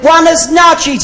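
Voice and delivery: high, slow, sing-song Spanish buenas noches; mock Spanish greeting. Comedian tries a friendly Spanish greeting on a passing man. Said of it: "So I said".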